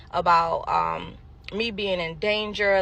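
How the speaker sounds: normal talking voice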